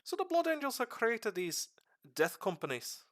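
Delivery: rough put-on Irish accent held through a lore talk; streamer's half-Irish accent. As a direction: explain the lore in a loose, slipping Irish accent. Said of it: "I'm not even sure what kind of accent I'm doing right now".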